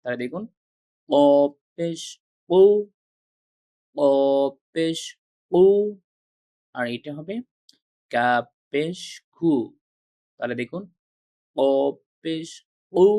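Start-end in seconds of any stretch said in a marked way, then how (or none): 1.1-2.6 ক পেশ কু said heavy from the throat; heavy throaty kaf. Shown as a demonstration. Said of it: "একটির উচ্চারণ একটু ভারী হবে". 8.1-9.5 কা পেশ কু said light and clear; light kaf. Shown as a demonstration. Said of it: "আর একটির উচ্চারণ হালকা হবে".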